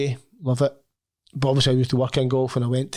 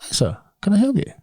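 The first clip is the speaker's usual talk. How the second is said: posh gatekeeper; 7 semitones higher, 3 dB louder than usual. Retold or imitated. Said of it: "and he said".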